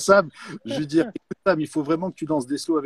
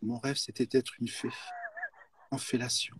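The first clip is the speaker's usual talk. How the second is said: quiet, downcast mock sadness; sad; 2 semitones lower, 12 dB quieter than usual. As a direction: say it softly, crestfallen. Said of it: "en étant triste".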